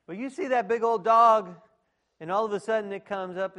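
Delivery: loud, high, excited build-up. Acted. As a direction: pump the crowd up about the big dog. Excited storytelling about dog.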